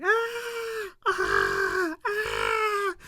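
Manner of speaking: hammed-up shrieks of surprise; mock mutual scream. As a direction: scream back and forth like startled cartoon characters. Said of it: "they both scream at each other like".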